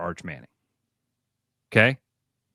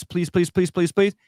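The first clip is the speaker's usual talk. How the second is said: mock begging; 5 semitones higher than usual. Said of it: "come on and go".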